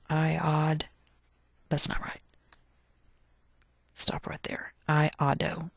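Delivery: half-whispered self-correction under the breath. Real, not acted. Teacher whispering to herself while fixing the spelling, hushed. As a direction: whisper it to yourself as you catch the typo.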